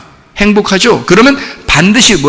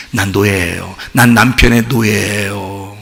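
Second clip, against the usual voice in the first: low, slow, hushed confession; 순순한 고백; 10 semitones lower, 6 dB quieter, slower than usual. Acted. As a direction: softly own up, drawing out the endings. Wife owning herself a slave. (낮고 느리게 순순히 인정하는 말투)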